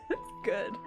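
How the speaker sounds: ordinary talk, nothing marked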